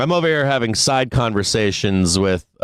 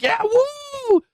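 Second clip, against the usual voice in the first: excited whoop. Retold or imitated. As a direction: whoop like a giddy trader. Joining the trader cheer.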